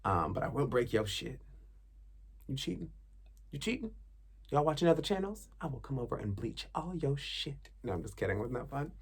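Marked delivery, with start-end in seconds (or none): 2.5-3.9 high, soft suspicious question; mock jealous. To viewers watching other channels, high mock suspicion. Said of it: "that was acting". 4.5-7.7 mock threat. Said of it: "that was acting".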